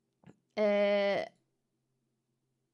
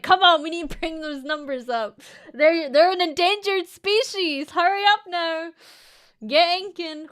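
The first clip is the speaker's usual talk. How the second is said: scientist voice; 5 semitones higher, 6 dB louder than usual. Retold or imitated. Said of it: "the scientist's like".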